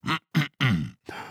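creaky throat clear before speaking. A narrator's reading. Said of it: "räusperte sich".

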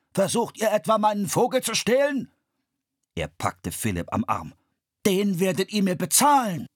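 loud, high scolding merchant; angry accusation. A narrator's reading. The bird seller scolds the children for stealing, angry. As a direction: catch them red-handed and threaten.

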